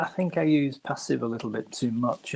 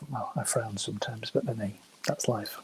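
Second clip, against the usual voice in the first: whispered aside; 11 dB quieter than usual. Real, not acted. Wry aside about grammar sticklers.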